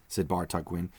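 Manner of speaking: ordinary delivery, nothing marked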